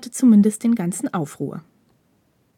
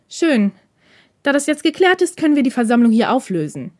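kalte Stimme; 5 semitones higher, 5 dB louder than usual. Acted. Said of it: "fuhr Hirakawas kalte Stimme über die Köpfe der Schüler hinweg".

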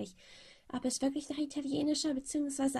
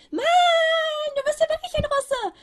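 much higher, loud excited shout; 12 dB louder than usual. Acted. Boy realising the narrator is Russian, loud high shout. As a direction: yell it out in amazement. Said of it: "Sofortiges Schreien".

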